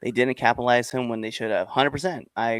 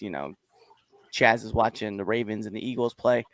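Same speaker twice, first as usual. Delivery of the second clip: ordinary delivery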